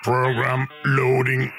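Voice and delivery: slow monotone system voice; computer announcement. Acted. Game announcing a program load, computer voice. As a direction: announce it like a machine, slowly.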